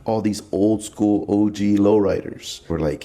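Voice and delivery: usual speaking voice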